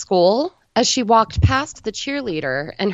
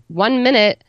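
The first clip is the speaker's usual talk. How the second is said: raised, higher call across the yard; called out shout; 5 semitones higher, 4 dB louder than usual. A narrator's reading. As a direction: shout it to the boys by the fire. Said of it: "and she shouted".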